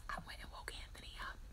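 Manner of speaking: quick, voiceless whisper; very soft whispered aside; hushed confession. Real, not acted. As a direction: whisper it fast, like owning up. Confiding what she did, sheepishly.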